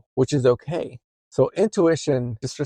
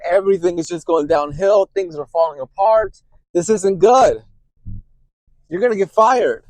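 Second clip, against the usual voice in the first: loud and panicky, driving the alarm; anxiety yelling; 5 semitones higher, 6 dB louder than usual. Retold or imitated. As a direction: yell it like a voice grabbing your collar. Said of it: "screaming at you".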